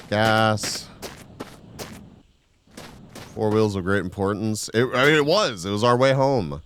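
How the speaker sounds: talking around a cigarette; cigarette in mouth. Real, not acted. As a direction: cigarette in mouth, slow reading. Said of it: "with a cigarette in my mouth".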